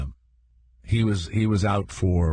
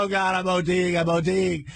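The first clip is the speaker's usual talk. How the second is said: high, hammed-up wail of fear; mock panic; 8 semitones higher than usual. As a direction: play a man sure he's overdosing. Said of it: "I go".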